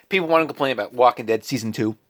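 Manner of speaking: usual speaking voice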